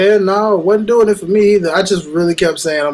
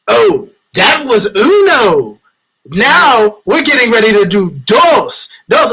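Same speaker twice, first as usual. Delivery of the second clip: slow, loud, higher condescending imitation; mocking slow talk; 5 semitones higher, 5 dB louder, slower than usual. Wrestler slow-talking Mexican opponents, mockingly imitated. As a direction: spell it out slowly as if to non-speakers. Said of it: "You really trying to slow talk".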